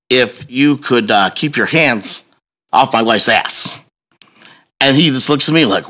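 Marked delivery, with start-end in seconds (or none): 0.1-3.8 slow, deliberate, spaced-out request; measured warning. Retold or imitated. Husband confronting the man who groped his wife, measured. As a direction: spell it out slowly with pauses.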